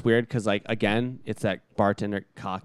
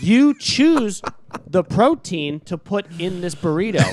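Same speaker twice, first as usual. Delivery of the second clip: high, loud, spelling it out for a dense customer; retold server at the end of their patience; exasperated explaining; 7 semitones higher, 7 dB louder, slower than usual.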